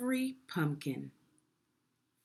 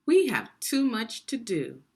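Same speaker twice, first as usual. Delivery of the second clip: loud, high, impatient middle brother; busy middle brother; 6 semitones higher, 6 dB louder than usual. Acted. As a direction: brush him off, too busy to help. Middle brother brushes off again.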